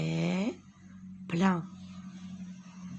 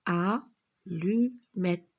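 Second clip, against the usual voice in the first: slow syllables; 4 dB louder, slower than usual. Shown as a demonstration. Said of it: "répétez après moi".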